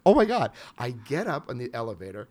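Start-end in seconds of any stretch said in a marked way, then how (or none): 0.0-0.5 higher and louder, stunned exclamation; overwhelmed realisation, louder; amazed. Retold or imitated. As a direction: gasp it out in disbelief. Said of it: "And I'm like".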